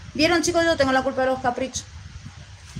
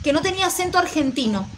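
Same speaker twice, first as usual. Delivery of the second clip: ordinary delivery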